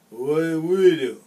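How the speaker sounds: slow, slurred and loud, like he's high. Retold or imitated. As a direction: drag the words together, slurring. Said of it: "in a slurred voice".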